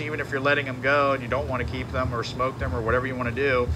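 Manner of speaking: ordinary delivery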